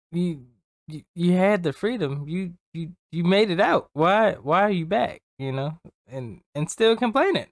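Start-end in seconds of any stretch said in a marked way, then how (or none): 0.1-5.2 loud, high, exasperated you-had-the-freedom; exasperated shout. Retold or imitated. Speaker to coworker who came back, exasperated loud shout. Quoting own reaction to returning coworker. 6.1-7.5 exasperated. Real, not acted. Continuing exasperated rant.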